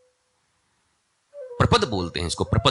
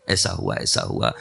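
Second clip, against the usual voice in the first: much lower, rumbling "ऐसा हुआ"; deep retelling voice. Retold or imitated. Hanuman recounting deeds to Ram, low and rumbling. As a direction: drop into a low storyteller's mumble.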